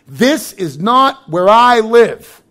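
drawn out slowly, leaning on not; slow emphatic declaration. Acted. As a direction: stretch every word, press hard on not. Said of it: "preacher declares slowly".

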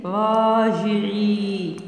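"راجعي" with every long vowel held; slow dictation. Shown as a demonstration. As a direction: draw out "راجعي" almost in a chant. Teacher dictates stretched word.

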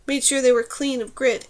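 plain reading voice

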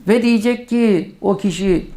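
usual speaking voice

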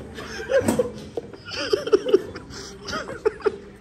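long wheezing laugh, up high. Real, not acted. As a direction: burst into breathless laughter. Laughing after teasing clerk.

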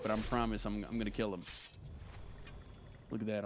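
ordinary talk, nothing marked

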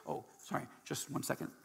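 ordinary delivery

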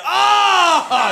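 high, loud yelp; husband realizing he forgot his checks; startled oh. Retold or imitated. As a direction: caught completely off guard. Husband caught out.

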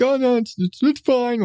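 high, halting idiot brushing it off; generic idiot voice. Acted. Podcast host mocks the man waving off danger, generic idiot voice. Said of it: "my default idiot voice".

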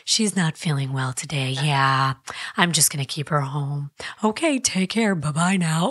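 put-on grown-up mother on the phone, pitch sinking low; mom voice. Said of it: "I would do the mom voice".

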